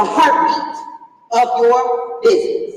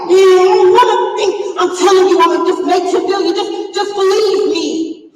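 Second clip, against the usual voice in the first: mock a pleading, whiny client; whining little coaches; 7 semitones higher, 4 dB louder than usual. Coach imitating clients who won't think, high whine. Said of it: "my little coaches, they be like".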